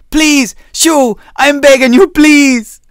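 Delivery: high, loud, pleading scream; desperate begging. Acted. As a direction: beg with everything you have. Zane begs for his life.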